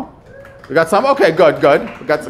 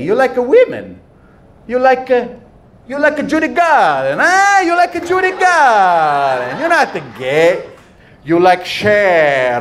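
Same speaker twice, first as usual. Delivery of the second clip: put-on high, heavy Italian-accented aunt; 5 semitones higher, slower than usual. Comedian imitating his Italian aunt teasing him, heavy Italian accent. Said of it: "and she'd be like".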